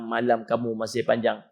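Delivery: fading devil whisper. Retold or imitated. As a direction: let the temptation sink almost to nothing. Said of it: "syaitan akan bisikkan".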